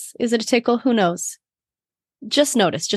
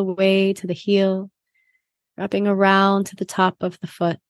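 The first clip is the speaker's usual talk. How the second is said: guided meditation. Acted.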